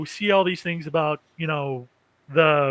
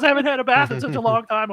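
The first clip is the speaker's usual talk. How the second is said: put-on higher, fast eager girl voice; lightly voiced excited girl; girlish plea; 6 semitones higher, 3 dB louder, faster than usual. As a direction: gush like a little girl. Girl pleading about bath.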